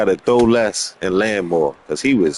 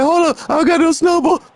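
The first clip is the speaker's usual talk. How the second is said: pitched-up mock panicked kid; high mock voice. Mocking people caught without a snowball, high squeaky voice. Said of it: "But they be like".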